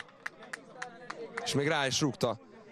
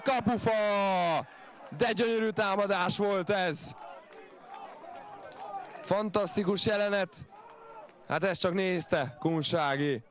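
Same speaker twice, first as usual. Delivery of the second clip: excited hungarian sports commentary; 6 semitones higher than usual. Real, not acted. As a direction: build up to a shout at the post. Near miss, ball hits post.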